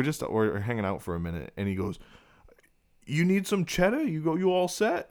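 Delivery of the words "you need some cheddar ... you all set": put-on eager drunk dad. Dad offers cash at midnight.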